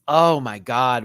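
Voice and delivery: ordinary talk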